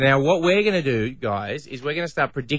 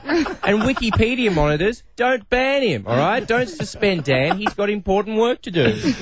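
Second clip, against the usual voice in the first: mock plea; 8 semitones higher than usual. Pleading with the Wikipedia moderators.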